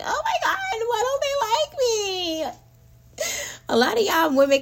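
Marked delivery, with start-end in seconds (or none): 0.0-2.4 high, wailing sob through a half laugh; mock your old self bawling with a laugh; hysterical crying. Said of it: "I would be hysterically crying like".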